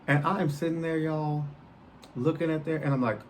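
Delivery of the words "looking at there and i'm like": ordinary talk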